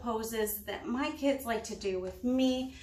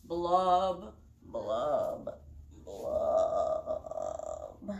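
blub, blub, blub, each one dropping lower in pitch; blub lower each time; 10 semitones lower, much slower than usual. Shown as a demonstration. Mom leading kids' yoga, blub sinking lower each time. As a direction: sink the pitch on every blub. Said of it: "get lower and lower every time".

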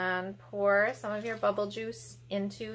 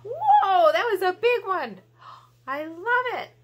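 much higher and louder, gasping with delight; thrilled at a big bubble for the kids; delighted squeal; 14 semitones higher, 6 dB louder than usual. Acted.

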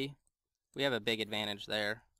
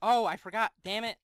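sudden realisation; 8 semitones higher, 5 dB louder than usual. Real, not acted. Realising mistake mid-game.

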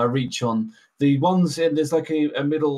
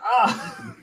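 high, sudden startled yelp; a sharp high yelp of surprise; startled cry; 11 semitones higher, 4 dB louder than usual. Retold or imitated. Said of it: "and he's like".

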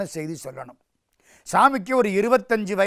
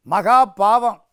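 loud, higher-pitched pronouncement of guilt; stern condemnation; 4 semitones higher, 8 dB louder, much slower than usual. Acted. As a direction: scold the culprit forcefully. Elder brother condemns.

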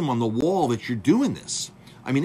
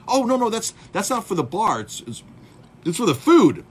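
flustered manager; 8 semitones higher, 6 dB louder than usual. Retold or imitated. Manager's flustered excuse retold.

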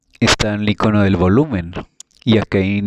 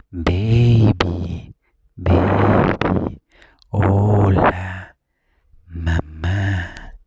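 put-on tender baby talk; much slower than usual. Shown as a demonstration. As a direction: murmur gently to your little one. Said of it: "así". (hablando con ternura al oído del niño, suave y cariñoso)

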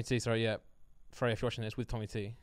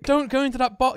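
high, loud, desperate calling out; pleading shout; 11 semitones higher, 7 dB louder than usual. Pretend. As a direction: beg Tommy to stop, shouting high. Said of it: "I'm like".

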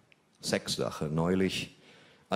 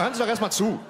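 much higher, laughing plea; high mock protest; 13 semitones higher, 4 dB louder, faster than usual. Real, not acted. Pleading with the laughing audience to listen, high mock protest. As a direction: hush the audience, high-pitched.

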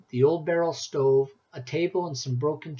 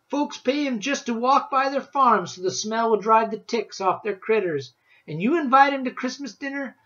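put-on loud, high, fussing old granny; scolding granny; 6 semitones higher, 6 dB louder than usual. A narrator's reading. Granny scolds grandson for inviting Rancid, loud.

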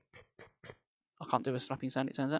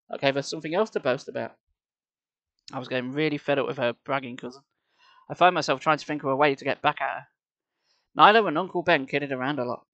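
plain reading voice

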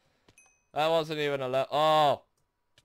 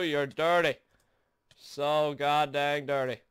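usual speaking voice

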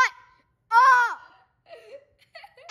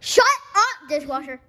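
retold shout; 2 semitones higher, 7 dB louder than usual. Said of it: "That's why I was saying".

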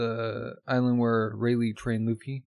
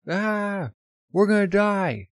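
high, loud panic; panicked yell; 10 semitones higher, 5 dB louder, slower than usual. Retold or imitated. Crew panics on the knock-up stream, frightened yell. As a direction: scream as the ship shoots upward.

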